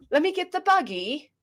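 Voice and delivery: high, posh put-on voice. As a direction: ask in a high-pitched posh accent. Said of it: "It sounds all posh".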